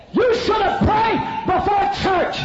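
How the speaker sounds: high, slow, loud bellow; shouted scolding. Retold or imitated. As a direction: yell the rebuke word by word. Pastor berates people at altar.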